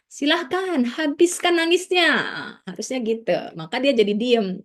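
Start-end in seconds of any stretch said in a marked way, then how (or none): 0.1-2.1 high, coaxing invitation to cry; permissive parent. Shown as a demonstration. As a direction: urge the child gently to cry it out.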